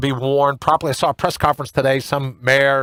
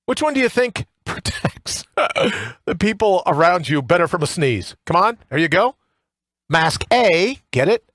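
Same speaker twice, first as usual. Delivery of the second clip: usual speaking voice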